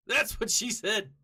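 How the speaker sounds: loud, high jokey outburst; blurted punchline. Real, not acted. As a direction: crow the cheeky punchline out loud. Blurts out a stock joke.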